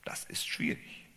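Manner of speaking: leise geflüstertes Beiseite; flüster es dir selbst zu; Flüstern. Real, not acted. Whispered aside. (geflüsterter Nebenkommentar)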